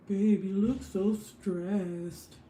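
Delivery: very slow, drawn-out husband impression; mock husband voice. Husband coming home to stressed wife, mock husband voice. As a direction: put on a dragging voice for your husband. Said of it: "why his voiceover is like that".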